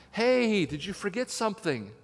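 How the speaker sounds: high, loud hail, then easing off; calling out. Retold or imitated. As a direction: imitate a shop assistant hailing a customer. Saleswoman calling up the aisle.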